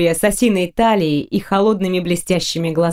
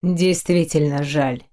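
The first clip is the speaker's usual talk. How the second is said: cautious; 5 semitones lower, slower than usual. A narrator's reading. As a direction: answer carefully and slowly. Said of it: "осторожно ответил Дайрен".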